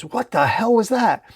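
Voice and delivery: high, alarmed exclamation; high-pitched startled question; startled yell. A narrator's reading. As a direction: cry out in shock without much volume. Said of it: "Dylan yells".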